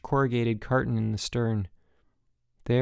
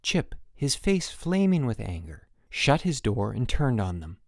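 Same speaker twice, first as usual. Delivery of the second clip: ordinary delivery, nothing marked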